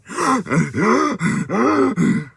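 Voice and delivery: repeated high, heaving gasps; imitated panic gasping. Dreamer showing something wrong in their body, acted gasping. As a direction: act out struggling for air, gasp after gasp. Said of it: "heart attack slash panic attack".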